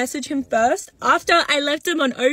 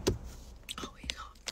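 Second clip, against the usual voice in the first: whisper; 21 dB quieter, much slower than usual. Real, not acted. Confessing her stalking, sheepish.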